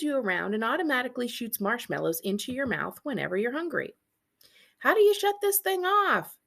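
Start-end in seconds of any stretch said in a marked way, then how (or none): 4.8-6.4 high, loud flustered plea; overwhelmed by the machine; cartoon fluster; mock panic. Marshmallow machine overwhelms character.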